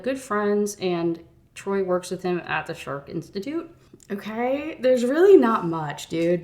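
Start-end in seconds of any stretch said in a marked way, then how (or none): 4.1-6.4 louder and slower, fed up; exasperated. Real, not acted. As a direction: drag it out, annoyed. Complaining about boring chapter.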